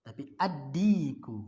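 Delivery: addiku slow, doubled d; right way. Shown as a demonstration. Teacher corrects students with a slow addiku. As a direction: sound addiku slowly with a doubled d. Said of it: "bukan aldiku".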